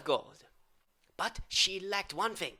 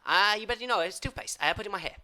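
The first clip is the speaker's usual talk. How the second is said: ordinary delivery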